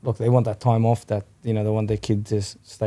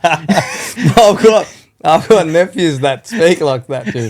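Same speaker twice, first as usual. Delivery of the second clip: laughing; 6 semitones higher, 9 dB louder, much slower than usual. Real, not acted. Laughing in reply.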